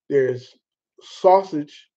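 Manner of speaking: ordinary talk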